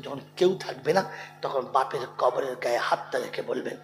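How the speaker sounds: usual speaking voice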